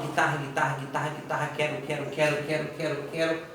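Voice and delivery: quick, low, even run of repeats; rapid repetition drill. Shown as a demonstration. Teacher showing students a repetition exercise, rapid repeated words. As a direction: repeat it softly over and over without pausing. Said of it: "é ficar repetindo".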